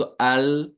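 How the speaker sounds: अल said slowly, the ल slipping slightly; slow slipping lam. Shown as a demonstration. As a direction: draw out अल with a gentle slide of the tongue. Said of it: "मामूली सा इनहिराफ होता है". (धीमा अल, हल्की फिसलन के साथ)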